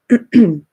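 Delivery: a loud, sharp clearing of the throat. Real, not acted. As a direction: clear your throat hard before going on. Recovering voice before topic.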